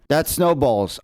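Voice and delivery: usual speaking voice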